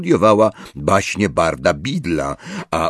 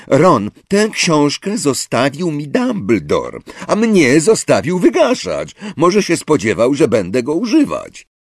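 Hermione, indignant; 7 semitones higher, 4 dB louder than usual. A narrator's reading. Hermione voiced in argument.

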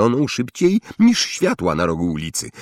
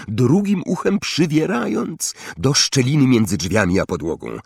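ordinary delivery, nothing marked

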